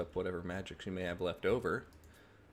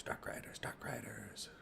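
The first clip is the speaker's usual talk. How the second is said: fading whisper; 3 semitones higher, 11 dB quieter than usual. Shown as a demonstration. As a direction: whisper growing fainter. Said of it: "what this army really needed is Dark Riders".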